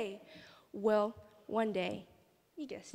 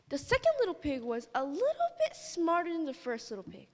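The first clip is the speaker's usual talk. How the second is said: usual speaking voice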